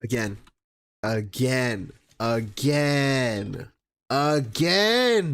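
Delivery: "Again" repeated with pauses, starting low and rising; fed-up repetition. Real, not acted. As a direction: build each "Again" from low and weary to emphatic.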